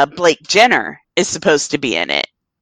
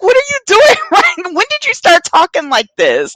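much higher, stunned and confused; as if she can't believe her ears; incredulous disbelief; 13 semitones higher than usual. Retold or imitated. She confronts Ryan in disbelief.

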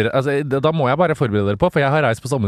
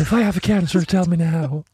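mock quote; 7 semitones higher, slower than usual. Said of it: "Sitter du der og sier".

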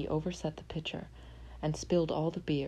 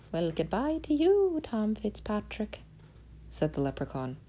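leprechaun. Acted. Leprechaun's parting words.

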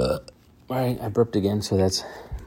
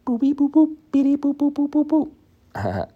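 high, loud sung beeps like a keypad; phone dial tones; 6 dB louder than usual. Pretend. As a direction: mimic a phone keypad being dialed, much higher and louder. Dialing Dax on the phone.

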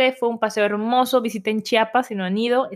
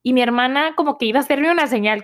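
usual speaking voice

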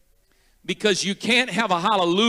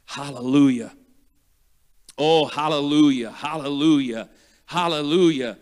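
churchgoers praying hallelujah. Retold or imitated. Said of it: "they'd be saying".

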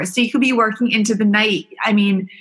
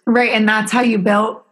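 ordinary talk

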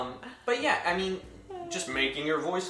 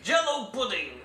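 a hint of Bill Cosby, pitched up; lightly voiced Cosby pitch line; comedian's light Cosby impression; 7 semitones higher, 4 dB louder than usual. Shown as a demonstration. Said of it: "my Bill Cosby impression".

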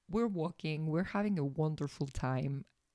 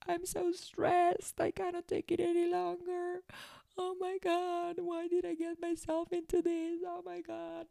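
much higher, whiny wailing cry; mock sobbing. Retelling her restaurant meltdown to listeners, high mock sob. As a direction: whimper it like a meltdown. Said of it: "I was literally sobbing and crying".

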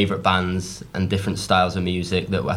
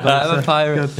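higher-pitched joking pirate; 6 semitones higher, 4 dB louder than usual. Pretend. Bandmate repeating the pirate joke, pirate voice. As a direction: lean into a comic pirate voice. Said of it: "You sound like".

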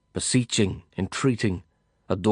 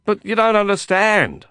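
insisting; 12 semitones higher, 6 dB louder than usual. A narrator's reading. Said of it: "I insisted".

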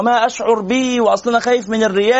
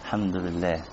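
hollow praise; 14 semitones lower, 13 dB quieter than usual. Retold or imitated. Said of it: "مش انه".

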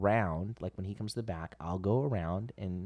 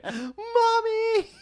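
put-on high loud little kid cry; little boy's high cry of relief; child calling mom; 11 dB louder than usual. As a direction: shriek for mommy like a scared toddler. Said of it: "And I went".

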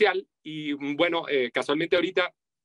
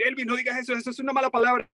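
scolding listener; 9 semitones higher, faster than usual. Retold or imitated. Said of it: "me van a decir".

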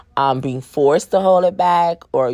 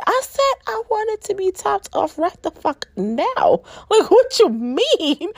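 outraged demand. Retold or imitated. Demanding the partner finish right now, in high mock outrage. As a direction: play it exaggeratedly incredulous.

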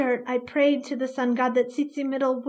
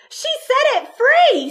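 high, loud holler from the crowd; a townsperson hollering in outrage; 13 semitones higher, 10 dB louder than usual. Acted. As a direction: yell out the accusation. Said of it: "someone hollered".

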